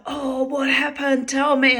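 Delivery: put-on high, eager girlfriend voice pumping for gossip; gossipy friend's voice. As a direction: voice the nosy friend, pitched high and loud. Friend Denature voiced in story.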